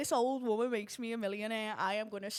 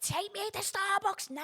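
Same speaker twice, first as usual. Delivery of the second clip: eager demand; 10 semitones higher than usual. Retold or imitated. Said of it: "George was like".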